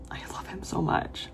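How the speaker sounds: slow, soft whisper; whispered love for a character; adoring whisper. Real, not acted. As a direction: sigh it out fondly. Adoring reaction.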